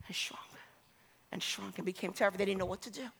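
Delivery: low, very soft storytelling; subdued, low and quiet; hushed narration. A narrator's reading. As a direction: shrink the voice down almost to a whisper. Hushed telling of fear.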